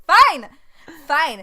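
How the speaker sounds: high, very loud giving in; mock exasperation. Giving in to a teasing co-host, mock exasperated. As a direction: throw up a loud, playful surrender.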